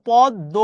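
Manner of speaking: "পদ্ম" said pod-do, with doubled d; silent-m pronunciation. Shown as a demonstration. Teacher correcting a tricky word, "পদ্ম" as pod-do. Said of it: "এটার উচ্চারণ কিন্তু".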